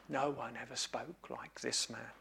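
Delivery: very soft, low, awestruck trailing off; near-whispered echo. Acted. Officers repeating their report, barely above a breath. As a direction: let it fade almost to nothing in wonder.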